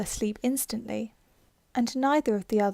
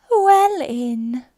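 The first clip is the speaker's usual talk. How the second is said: sleepy Dormouse; 3 semitones higher, 10 dB louder than usual. Acted. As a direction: drawl it out like a half-asleep mouse. Dormouse punchline.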